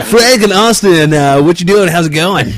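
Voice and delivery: high, loud call-in show host; radio host voice. Retold or imitated. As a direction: greet the caller like a radio DJ. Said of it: "you do sound like a call-in guest".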